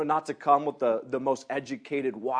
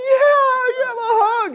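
much higher and louder, near tears of joy; overjoyed; 11 dB louder than usual. Real, not acted. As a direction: burst out with emotional delight. Punk asks for a hug.

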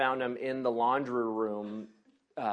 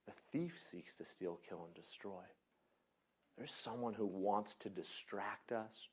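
hushed, earnest sermon with long pauses; soft reflective preaching; 3 semitones lower, 12 dB quieter than usual. Real, not acted. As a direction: bring it down to a quiet, weighty reflection.

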